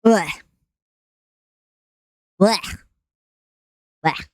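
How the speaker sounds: gagging blech sound; short retching blech; disgusted blech. Real, not acted. Disgust noise.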